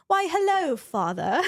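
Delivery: lilting British princess greeting; British accent. Retold or imitated. Daughter greeting her father in a mock British accent. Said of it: "watching, like, Princess Diana".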